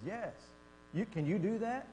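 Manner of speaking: usual speaking voice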